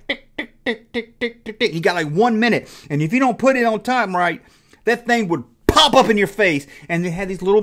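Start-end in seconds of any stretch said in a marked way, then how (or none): 0.1-1.7 high, soft ticking sounds; ticking timer. Retold or imitated. Describing the Perfection game's timer, high soft ticking. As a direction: tick like a countdown timer. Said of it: "you can hear the thing ticking".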